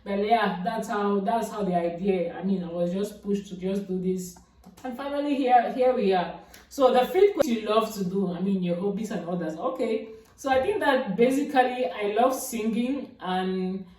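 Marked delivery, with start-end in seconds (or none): none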